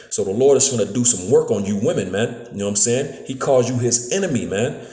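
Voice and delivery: usual speaking voice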